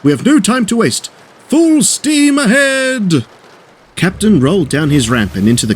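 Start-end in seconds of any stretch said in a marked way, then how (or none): 0.0-1.1 high, pressing call to action; urgent. A narrator's reading. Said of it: "he said urgently". 1.5-3.2 high, long-drawn rallying cry; heroic call. A narrator's reading. Captain calls out setting off.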